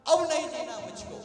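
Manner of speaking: loud burst of exasperated despair; anguished agitation. Retold or imitated. Mirabai fed up with worldly life, anguished outburst. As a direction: cry it out, hit the first word hard. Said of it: "Mirabai को मन में बड़ा क्षोभ हुआ".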